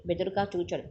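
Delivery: ordinary delivery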